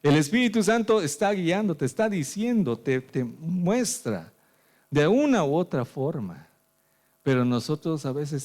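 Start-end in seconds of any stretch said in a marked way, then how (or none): 0.0-6.5 raised, fervent preacher voice; preaching. Acted. Pastor preaching to the congregation, fervent. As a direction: preach it out with force.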